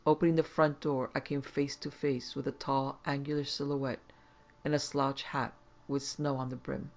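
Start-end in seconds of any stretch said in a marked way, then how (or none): none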